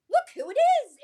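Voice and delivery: high, loud acted delight; excited announcement. Panel host points out a fan favorite, acted excitement. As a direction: build up the big reveal.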